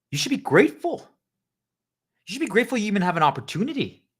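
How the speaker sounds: shouted, high and loud; indignant boss rant. Acted. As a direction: tear into them like an offended boss. Boss berating the team.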